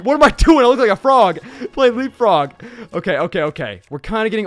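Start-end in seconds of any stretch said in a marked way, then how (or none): 0.0-2.5 loud, high-pitched, frantic; flustered outburst. Real, not acted. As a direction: exclaim it in mid-game chaos. Flustered outburst during game combat.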